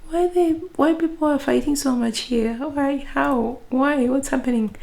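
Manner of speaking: high, alarmed questions, voice pitched well up; bewildered newcomer. Retold or imitated. A newcomer recalls hearing people fight, high shocked questions. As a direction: act out your shock at the shouting. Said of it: "I was".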